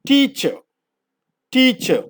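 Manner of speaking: Teacher said with a stretched ee start; Teacher, sounded out slowly; modelled pronunciation. Shown as a demonstration. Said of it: "The underlined letter E signifies the sound A". (Teacher ഉച്ചാരണം കാണിക്കുന്നു)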